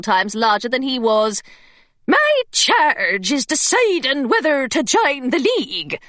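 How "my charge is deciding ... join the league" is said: put-on higher, rough pirate accent, governess in disguise; thick pirate accent. A narrator's reading. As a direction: sell the pirate accent hard. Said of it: "in a thick pirate accent".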